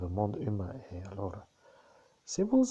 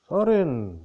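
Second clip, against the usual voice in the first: loud call; 8 semitones higher, 13 dB louder than usual. Acted. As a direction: call the name out high and loud. Calling out the name Sorin.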